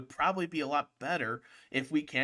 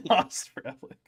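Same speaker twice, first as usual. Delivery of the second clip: laughing; 6 semitones higher, 5 dB louder than usual. Real, not acted. Echoing a friend's phrase while laughing.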